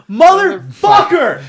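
high, very loud, drawn-out shout; exasperated yell. Acted. Fan reacting to a comic's plot twist, exasperated yell. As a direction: bellow the curse slowly at full force. Mock outburst at the retold plot.

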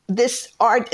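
ordinary talk, nothing marked

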